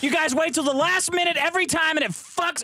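high, hectoring demand; hammed-up exasperated hustle for money; mock angry rant.

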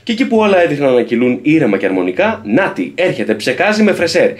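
high, loud announcer read; hammed-up TV trailer narration; dramatic trailer voice-over. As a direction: sell it like a reality-show promo. Reads promo text.

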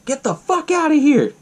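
high-pitched mock outburst; hammed-up indignant high voice; pissed off, retold. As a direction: act out an annoyed woman telling someone off. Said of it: "she was getting pissed".

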